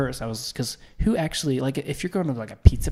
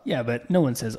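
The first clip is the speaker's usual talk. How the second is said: usual speaking voice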